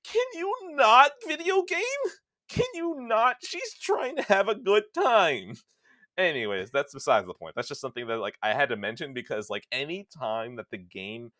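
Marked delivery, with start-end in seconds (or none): 0.0-5.6 much higher and louder, slowed plea; exasperated plea. Retold or imitated. Husband begging the game to spare his wife, high and loud. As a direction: beg the game to stop hurting her.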